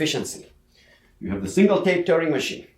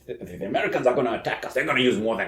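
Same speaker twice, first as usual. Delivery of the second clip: inner thought; 5 semitones lower, 7 dB quieter than usual. Retold or imitated.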